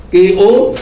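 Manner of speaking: normal talking voice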